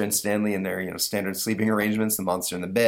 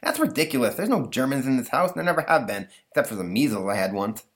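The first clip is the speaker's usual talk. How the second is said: put-on higher, lisping kid voice; lisping boy Stanley, exasperated; 5 semitones higher than usual. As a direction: talk like an exasperated boy with a lisp.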